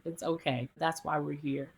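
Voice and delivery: soft, low and reassuring; calm a crying patient, softly; gentle reassurance. Retold or imitated. Doctor comforting a crying patient, softly reassuring. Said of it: "I said".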